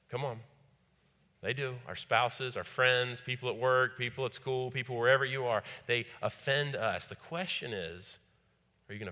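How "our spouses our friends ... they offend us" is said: loud, driving sermon voice; fervent preaching. Acted. Preaching at full force.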